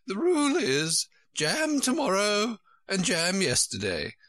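very high-pitched storybook queen; high Queen correcting Alice; fussy Queen. A narrator's reading. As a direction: put on a high, querulous royal voice.

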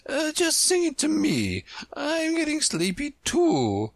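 a queen nodding off; sleepy queen. Acted. Queen drowsily asks.

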